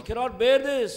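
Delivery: loud, high preaching cry; fiery preaching. Acted. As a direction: thunder it at the congregation. Preaching at full force.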